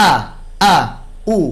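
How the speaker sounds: আ with a sharp glottal stop; firm hamza. Shown as a demonstration. Tajweed teacher drilling the hamza: আ from closed vocal cords. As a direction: close the vocal cords, then release আ firmly. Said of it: "ই শক্ত করে পড়তে হবে".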